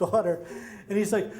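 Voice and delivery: ordinary talk, nothing marked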